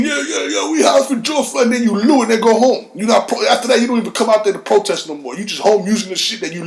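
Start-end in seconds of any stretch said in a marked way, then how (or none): 0.0-1.7 mocking looter.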